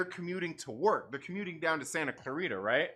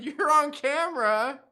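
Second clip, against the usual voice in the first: loud, slow, higher call; called out warning; 7 semitones higher, 7 dB louder, slower than usual. Retold or imitated. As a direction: call it after her. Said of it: "I told her, I said".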